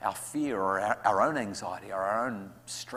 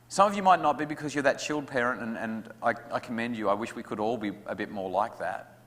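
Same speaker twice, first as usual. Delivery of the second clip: ordinary delivery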